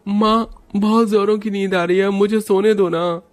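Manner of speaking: little Raj whining to his mother to let him sleep; sleepy little boy. Acted. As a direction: beg mom like an exhausted small kid. Sleepy child begs mother to sleep.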